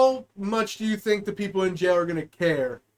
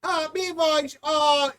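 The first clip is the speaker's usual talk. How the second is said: dispatcher voice pitched higher; 4 semitones higher than usual. Retold or imitated. Joking dispatcher radios units, voice pitched higher. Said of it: "like how high pitch you got".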